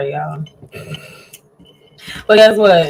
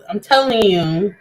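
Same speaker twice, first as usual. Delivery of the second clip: ordinary talk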